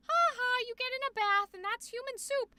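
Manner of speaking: high, loud, sing-out joke voice; mock jolly quip. Streamer mocking the harmless human soup joke, mock jolly. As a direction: toss it out high and quick, mockingly.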